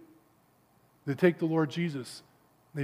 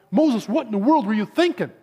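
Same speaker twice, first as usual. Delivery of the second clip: raised, louder mock protest; exasperated crowd; 5 semitones higher, 5 dB louder than usual. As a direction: demand an explanation in acted disbelief. People complain to Moses.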